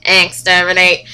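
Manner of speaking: loud, slow robotic Dalek voice; Dalek impression. Fan doing a Dalek for her viewers, loud and slow. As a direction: grind it out like a Dalek. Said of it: "here's my Dalek impression".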